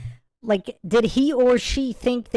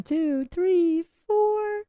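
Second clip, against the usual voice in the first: rising higher on each count; each number higher than the last; incredulous counting; 9 semitones higher, much slower than usual. Real, not acted. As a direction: count the steps in mounting disbelief. Counting steps in the clip.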